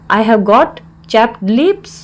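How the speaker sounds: slow, word-by-word teaching delivery; slow repeat. Shown as a demonstration. Teacher repeats the English phrase for learners, slowly.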